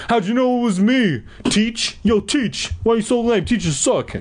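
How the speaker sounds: high-pitched, mouthy schoolboy voice; yelling Kevin. A narrator's reading. Student caught throwing an eraser mouths off at teacher, high yelling. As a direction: heckle the teacher in a high bratty voice. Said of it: "Kevin yelled".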